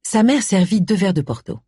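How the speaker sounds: ordinary delivery, nothing marked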